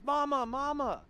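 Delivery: put-on high, loud child's call; shouting boy. Small boy running for his mother, high shout. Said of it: "and then he shouts".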